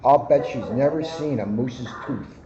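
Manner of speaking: ordinary delivery, nothing marked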